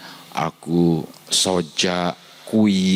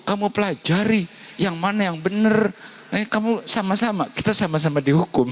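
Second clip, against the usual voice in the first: very high teasing rebuke; high mock reprimand; mocking scold. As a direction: needle him with a shrill high scolding. Preacher mocks the friend's fate.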